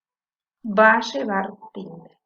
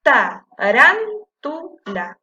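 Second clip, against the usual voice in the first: "Ta, ran, tu, la" in separate syllables, "ran" much louder; stress on RAN; 3 semitones higher, 7 dB louder, slower than usual. Shown as a demonstration. Teacher showing which syllable is strongest, stress on "ran". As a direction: punch "ran" hard within "Ta, ran, tu, la". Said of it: "es la que suena fuerte".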